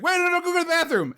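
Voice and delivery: high-pitched, hurried protest; flustered. Retold or imitated. Man answering the girl who needs the bathroom, flustered. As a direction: tumble it out in mock panic. Acts the man's hurried reply.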